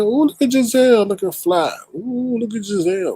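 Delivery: high, sing-song ooh over a viewer's look; playful admiring. Real, not acted. Host admiring Giselle looking fly, sing-song.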